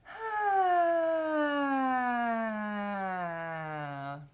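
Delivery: big voiced tongue-out sigh falling slowly; loud open sigh. Shown as a demonstration. Vocal coach demonstrates a loud tongue-out sigh release. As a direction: release a long loud haa. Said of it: "bring the tongue out of the mouth".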